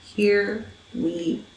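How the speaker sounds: soft, under-the-breath grumble; muttered under breath. Retold or imitated. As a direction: grumble it to yourself. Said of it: "I said".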